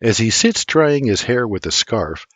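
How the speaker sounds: normal talking voice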